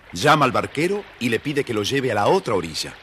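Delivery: ordinary delivery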